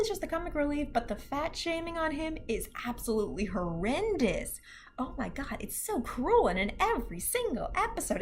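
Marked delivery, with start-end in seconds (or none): none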